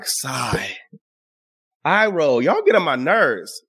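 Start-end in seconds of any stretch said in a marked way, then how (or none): 0.0-0.8 low, soft, spoken sigh; drawn-out, fed-up "sigh"; weary sigh. Acted. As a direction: say it deflated and over it. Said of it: "And I be like".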